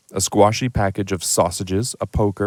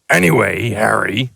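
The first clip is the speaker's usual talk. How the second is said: giant voice. Acted. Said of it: "said the giant".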